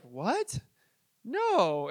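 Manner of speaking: acted disbelief, then refusal; mock startled denial. Traveler asked about moving to Texas, mock incredulous. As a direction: pitch swooping up on the question.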